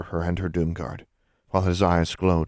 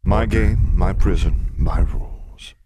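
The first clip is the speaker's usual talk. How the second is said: loud, emphatic monster voice; big beast laying down the law; domineering sphinx; 7 dB louder, slower than usual. Acted. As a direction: pound out each short phrase. Sphinx asserts his rules.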